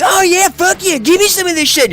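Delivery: high, loud, greedy cheering; hungry for the fight, squeal it out; eager trigger-happy gunner. Retold or imitated. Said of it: "she's like".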